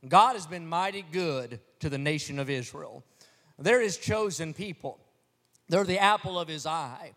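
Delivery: usual speaking voice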